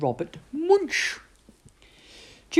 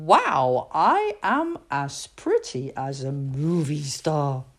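admiring herself; 4 semitones lower, slower than usual. Acted. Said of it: "looked in the mirror and said".

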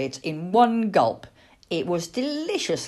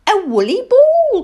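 put-on high, loud little-girl exclamation; excited cry; 11 dB louder than usual. Little girl finding an owl at her feet, excited cry. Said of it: "cried the little girl".

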